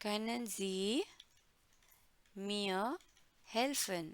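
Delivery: Können Sie, mir, helfen said with long pauses; slow drill, pausing between each word. Shown as a demonstration. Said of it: "आप अच्छे से पढ़ सकते हैं".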